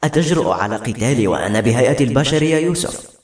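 usual speaking voice

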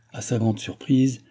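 usual speaking voice

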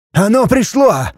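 high urgent warning shout. A narrator's reading. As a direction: yell out the warning. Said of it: "крикнул странник". (крик)

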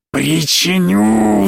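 put-on high, slow, drawn-out demon child; possessed child. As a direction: stretch it out like a gleeful little monster. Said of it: "задёргала ножками Аня". (одержимый ребёнок, тянет слово высоко)

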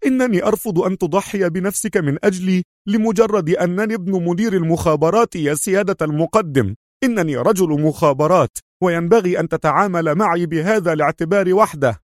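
high, heated protest; agitated. A narrator's reading. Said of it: "يستطرد في انفعال".

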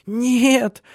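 high, loud tearful protest; crying out. A narrator's reading. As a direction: wail the refusal as the wife. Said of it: "заплакала она". (плачущий высокий вскрик)

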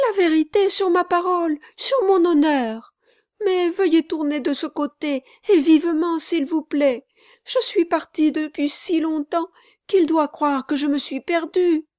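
high, pressing plea to hurry; a breathless servant begging him to drive on; urgent pleading girl. Acted. Marquise swearing, urging.

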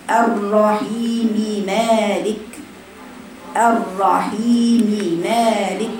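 very slow الرحيم مالك with a full كسرة on the م; full vowel reading. Shown as a demonstration. Teacher repeating the correct reading for students. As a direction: recite الرحيم مالك slowly, the كسرة complete. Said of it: "تقرأها هكذا".

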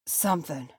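a touch out of breath; breathless. A narrator's reading. Dwarf confirming he hears something, breathless.

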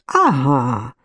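ordinary delivery

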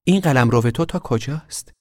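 lightly voiced curious question; curious girl. A narrator's reading. Girl suddenly curious about the boy's realm. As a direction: ask with sudden interest. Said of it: "ناگهان کنجکاو شده بود".

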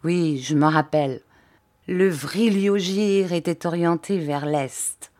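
ordinary delivery

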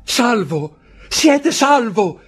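high and slow, choked with joy; breathless joyful cry. A narrator's reading. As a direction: daughter tells her father he is saved, overjoyed. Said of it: "ansante e quasi soffocata dalla gioia". (grido acuto e lento, pieno di gioia)

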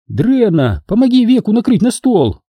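lightly voiced call to the girl to help. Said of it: "крикнула Нин".

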